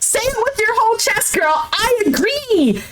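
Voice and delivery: high, insistent call-out; impassioned high-pitched insistence; emphatic urging. Real, not acted. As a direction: urge her on, stretching the last words. Urging her to admit it.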